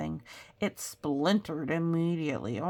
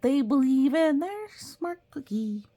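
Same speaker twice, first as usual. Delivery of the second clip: teacher's voice; 8 semitones higher, 6 dB louder than usual. Acted. Teacher voiced in read-aloud story.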